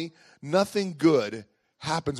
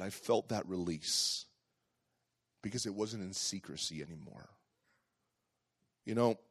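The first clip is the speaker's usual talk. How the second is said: softer, lower, reflective preaching; quiet sermon; 5 semitones lower, 7 dB quieter than usual. Acted. Pastor drawing the lesson for the congregation, softer and lower. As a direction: bring it down to a gentle lesson.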